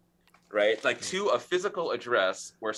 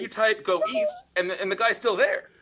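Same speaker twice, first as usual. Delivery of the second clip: much higher and louder retelling; excited, higher game story; 12 semitones higher, 6 dB louder than usual. Real, not acted. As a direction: pitch it up with excitement.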